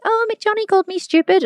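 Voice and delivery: put-on high, whiny child's complaint; whiny kid. Kid whining to mum about Johnny. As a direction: play the hurt little kid tattling. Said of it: "They're like".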